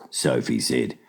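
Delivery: plain reading voice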